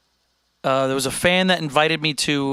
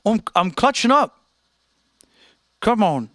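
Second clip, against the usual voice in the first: put-on higher-pitched rough Australian accent; shaky-confidence Australian imitation; attempted Australian accent; 6 semitones higher than usual. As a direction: have a go at an Aussie accent. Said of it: "I don't know if I can do an Australian".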